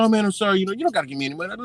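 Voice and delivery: high-pitched hurried apology; mock fast talking. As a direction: mimic someone apologizing in a rush. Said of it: "He's talking so fucking fast".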